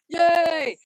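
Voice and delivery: loud, high yay; excited shout. Real, not acted. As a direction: shout for joy.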